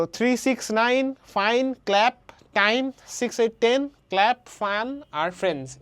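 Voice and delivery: each word from three to friends a separate beat; stressed-word list. Shown as a demonstration. Teacher reciting words students will clap on, each from three on stressed. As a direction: punch out nine, fine, clap, time like claps. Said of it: "stress syllable".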